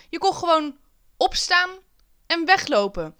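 mock amazement. Pupils discover they can just walk out, astonished. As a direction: marvel at it in high, broken-up phrases. Marvelling that one could just leave.

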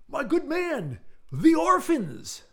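very high, loud melodramatic cry; frantic Englishman. A narrator's reading. Eccentric Englishman imploring his friend over orphans, frantic. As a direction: wail it, stretching the last words out slowly.

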